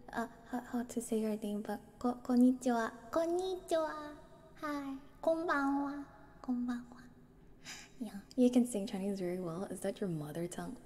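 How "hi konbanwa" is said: usual speaking voice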